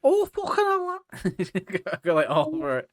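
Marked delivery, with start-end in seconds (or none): 0.0-1.0 very high mock Scouse outburst; Liverpool accent. Teasing friend who won't do it, high mock Scouse. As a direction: squeal it in caricature Scouse. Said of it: "pretty good Liverpoolian accent".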